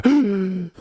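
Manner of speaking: sharp acted gasp for air; panicked gasp. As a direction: gasp as if you forgot how to breathe. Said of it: "then I forget how to breathe".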